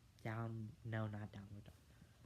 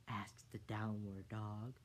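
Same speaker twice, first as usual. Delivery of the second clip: usual speaking voice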